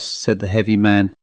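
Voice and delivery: ordinary delivery, nothing marked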